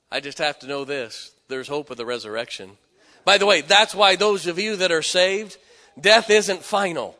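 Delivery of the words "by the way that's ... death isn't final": loud, high preacher's build; rising pulpit fervor; preaching at full force. Acted. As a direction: lift the room with hope.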